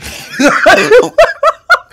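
high, loud burst of laughter; laughing. Real, not acted. Storyteller cracking up at own Kevin Hart comparison, laughing.

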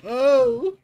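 surprised. Real, not acted. Reacts to discovery.

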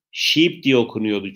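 normal talking voice

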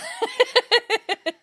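a high helpless cackle. Real, not acted. Co-host jokes about a pickled onion, she shrieks with laughter. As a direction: crack up at the pickled onion joke.

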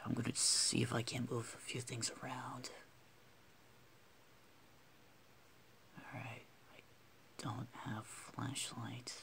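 soft whisper to avoid spooking him. Real, not acted. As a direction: keep it a hushed whisper. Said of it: "sorry for whispering".

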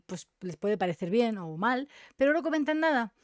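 ordinary talk

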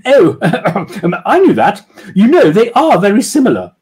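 high, loud lady with a nervous laugh; flustered posh woman; defensive. Acted. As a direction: cover the mistake, a little defensive. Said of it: "now sounded a little defensive".

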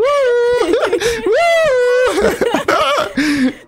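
high shrieking laugh. Real, not acted. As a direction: burst out laughing at the scream. Streamer laughs at partner's scream.

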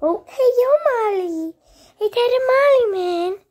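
high, excited coo to a dog; pet talk. Real, not acted. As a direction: greet your dog in a high, lively voice.

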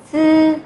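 "Sư" as unaspirated z, high, level, held; straight-tongue Z. Shown as a demonstration. Teacher rereads first sound for students, "Sư" as level z. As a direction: hold "Sư" steady with no puff of air. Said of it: "cô đọc lại ba âm này".

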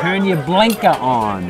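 put-on Boston accent, slow and drawn out. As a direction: drop the R like a Bostonian. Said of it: "With the Boston accent".

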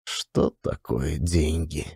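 usual speaking voice

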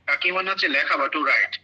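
usual speaking voice

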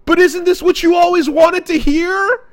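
high put-on girl voice, baffled; mock Sakura. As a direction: play her baffled and shrill-free but high and loud. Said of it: "And she goes".

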